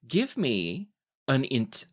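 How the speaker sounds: ordinary talk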